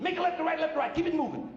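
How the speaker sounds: high, hurried mock bark; brusque direction-giver. Airport man directing a lost driver, high and brusque.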